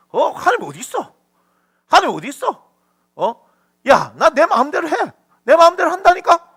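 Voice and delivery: a hint of a defiant braggart; mock arrogant sinner. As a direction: sneer like a man who fears nothing. Voicing the arrogant wicked man.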